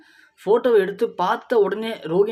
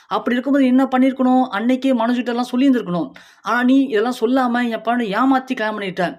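higher and louder, scolding the daughter-in-law; angry yelling; 5 semitones higher, 5 dB louder than usual. Acted. Mother-in-law berating Rohini for hiding her past, furious yell. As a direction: hurl the accusations at her in a rising rage. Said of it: "Vijaya கத்துறாங்க".

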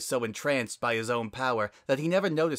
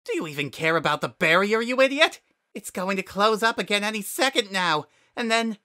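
high, loud, fast screeching rant; raging flower; 10 semitones higher, 6 dB louder than usual. Acted. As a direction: spit the insult out.

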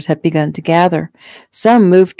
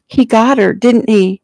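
higher, anxious cry; 6 semitones higher, 4 dB louder than usual. A narrator's reading. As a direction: blurt it out in distress. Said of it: "she cried".

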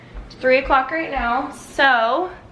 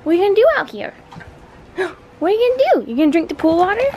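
high, loud gasping questions; startled, chase the dog with your voice; squeaky alarmed dog-mom voice; excited pet talk; 8 semitones higher, 7 dB louder, much faster than usual. Real, not acted. Dog heads toward the pool.

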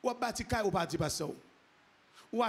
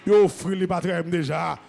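full-force preaching; 2 semitones higher, 11 dB louder, slower than usual. Real, not acted. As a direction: build to a ringing pulpit cry.

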